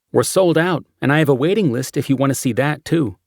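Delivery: chirpy ticket agent at the window; lightly voiced clerk.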